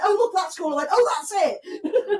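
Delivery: high, laughing mock voice; laughing mock quote. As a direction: imitate him through laughter. Imitating friend, laughing.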